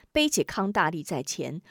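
usual speaking voice

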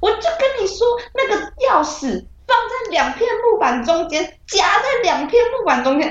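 high, agitated insisting; worked-up. Retold or imitated. Insisting to her brother about the key, high and agitated. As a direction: insist hard on it, all worked up. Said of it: "而且我还超激动的哦".

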